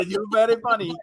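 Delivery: high mock shout, stress on very; Mama George impression. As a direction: tease like a scolding auntie. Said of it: "That entire impersonation".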